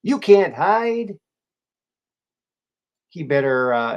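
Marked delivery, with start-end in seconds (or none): 0.0-1.2 slow, loud and higher mock menace; hammed-up villain warning, drawn out; a playful spooky taunt, stretched slow; ominous threat. Repeats the threat dramatically.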